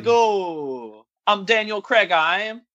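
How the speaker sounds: high-pitched, hammed-up mock accent; Daniel Craig. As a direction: push the accent up high and stretch it. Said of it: "That's his real accent".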